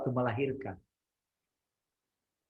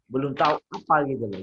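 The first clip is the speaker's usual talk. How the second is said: ordinary talk, nothing marked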